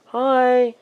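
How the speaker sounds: high, sing-song greeting; sweet high hi. Retold or imitated. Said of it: "be like".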